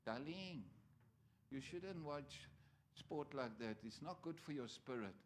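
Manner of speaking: a hint of his wife, soft and gentle; imitating wife. Preacher imitates his wife warning him about boxing, softly. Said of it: "she said, call me darling".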